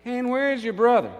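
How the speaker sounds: high, loud demand; urgent calling. Acted. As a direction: call out urgently as God seeking the missing brother. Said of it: "I've been calling for him".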